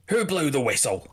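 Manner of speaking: lightly voiced guard's challenge; guard demanding. Guards stacked up at the door, demanding to know. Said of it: "and they're saying".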